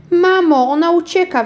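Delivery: put-on high, loud child's shriek. A narrator's reading. Girl shrieking to her mother that the doll runs away. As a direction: scream it up high to mum. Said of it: "wrzasnęła".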